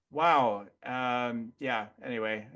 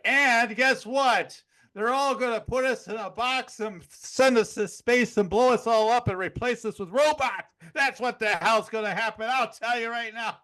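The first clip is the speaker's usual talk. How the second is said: higher, louder hammed-up alarmist tirade; over-the-top mock rant; 6 semitones higher, 3 dB louder than usual. Man adding onto someone's political fears, over-the-top mock rant. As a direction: pile on the outrage, hammed up.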